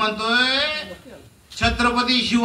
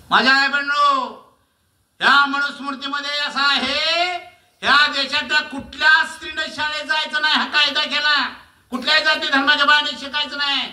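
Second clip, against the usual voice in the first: raised, high denouncing cry; fiery shout; 6 semitones higher than usual. Real, not acted. Orator denouncing the old law to the crowd, shouting. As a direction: hammer the injustice home.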